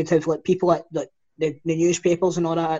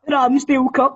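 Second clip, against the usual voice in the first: laughing; 7 semitones higher, 5 dB louder than usual. Retold or imitated. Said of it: "speaking in a Dutch accent".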